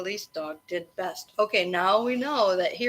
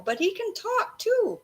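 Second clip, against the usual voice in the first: amazed; 12 semitones higher than usual. A narrator's reading. Noah amazed at the dog.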